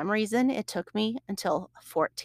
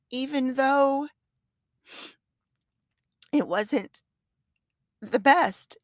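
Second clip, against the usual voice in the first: tearful; 6 semitones higher, slower than usual. Real, not acted. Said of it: "I don't know why I'm crying".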